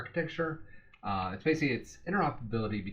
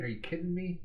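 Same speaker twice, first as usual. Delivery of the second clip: mock disbelief; 9 semitones higher, faster than usual. Mock disbelief at found data.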